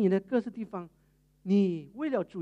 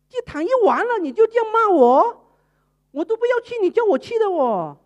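high, loud indignant talking back; indignant retort; 6 semitones higher, 5 dB louder than usual. Retold or imitated. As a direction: protest that it isn't fair. Maid talks back to mistress. (委屈又愤怒地顶嘴)

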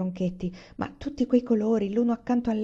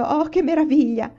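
higher, louder gasp of wonder; Mary thrilled by the Christmas feast, higher, louder exclaiming; delighted exclamation; 8 semitones higher, 6 dB louder than usual. Acted. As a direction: clasp your hands and marvel out loud. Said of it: "Mary giunse le mani esclamando".

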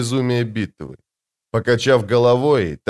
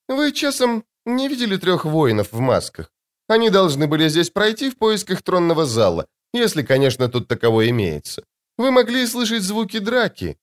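much higher, light and airy lady's voice; sweet woman's voice; 8 semitones higher than usual. Acted. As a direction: play the serene, otherworldly beauty.

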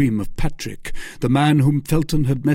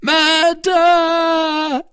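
long, very high scream; shrieked cry of murder; 6 dB louder than usual. A narrator's reading. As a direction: scream the alarm, stretching the word. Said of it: "screamed Patrick".

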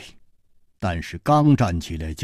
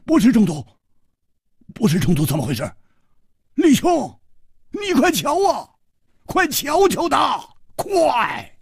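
high, loud panicked shouting; high-pitched cries for urgent help; frantic urging; 4 dB louder than usual. Acted. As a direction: urge someone desperately, pitched way up. Frantic plea to look at sick friend.

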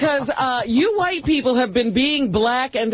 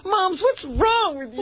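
mock street kid; 8 semitones higher, slower than usual. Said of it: "mocking mother exchange".

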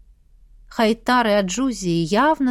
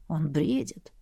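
softer, lower, murmured remark; quiet aside; 5 semitones lower, 7 dB quieter than usual. Acted. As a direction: murmur it over the patient. Bystander beside the feverish prince.

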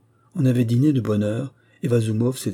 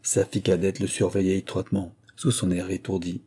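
ordinary delivery

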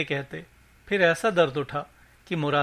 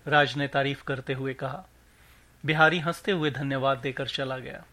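ordinary delivery, nothing marked

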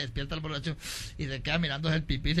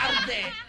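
stinging cry pitched much higher; pained wail. Acted. Drunk feels the lemon burn and wails in pain.

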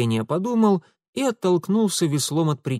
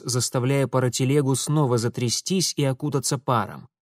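plain reading voice

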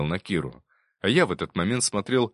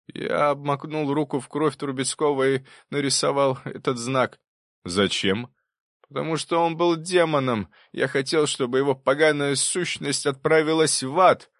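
higher-pitched, vehement confession; professor confessing, bitter; 7 semitones higher, 3 dB louder than usual. Acted. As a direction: explain your hatred to the court, pitched up. (профессор признаётся с ненавистью, голос повышен)

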